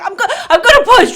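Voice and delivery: fast, high, loud outburst; retold urgent cry, stumbling in haste; frantic announcement. Retold frantic repeat to nurse.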